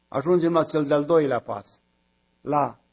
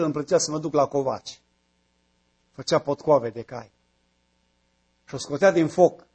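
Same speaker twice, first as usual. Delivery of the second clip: ordinary talk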